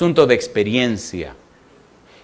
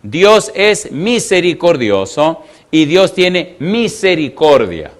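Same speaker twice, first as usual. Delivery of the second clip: slow emphatic statement; 2 semitones higher, slower than usual. Acted. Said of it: "preacher stresses key line".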